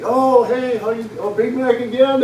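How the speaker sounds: put-on happy old lady recognizing a customer; cheery greeting. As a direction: greet a regular warmly and gladly.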